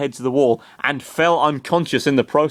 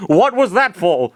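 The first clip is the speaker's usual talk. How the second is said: angry question; 7 semitones higher, 5 dB louder than usual. A narrator's reading. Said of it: "Chris asked angrily".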